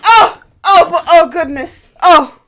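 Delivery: high, loud, flustered greeting; startled high-pitched priest welcoming riders. Acted. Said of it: "He goes".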